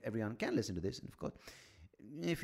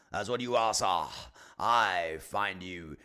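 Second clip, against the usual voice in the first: louder, slow haughty address; mock pompous insult; 7 dB louder, slower than usual. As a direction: sneer it like a pompous aristocrat.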